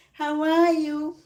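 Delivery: high, loud, slow sing-song; sing-song greeting. Real, not acted. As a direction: draw out the how are you. Repeated drawn-out greeting.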